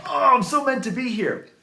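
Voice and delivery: high, dreamy rookie delight; starry-eyed thought. Retold or imitated. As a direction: gush it like you've found your calling.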